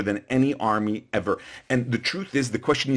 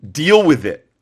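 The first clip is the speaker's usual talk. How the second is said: loud deal with it; 4 semitones higher, 8 dB louder than usual. Real, not acted. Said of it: "emphatic repetition".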